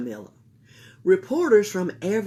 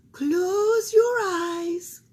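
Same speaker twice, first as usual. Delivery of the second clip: soothing therapist; 12 semitones higher, 6 dB louder, much slower than usual. A narrator's reading. Therapist voiced in story.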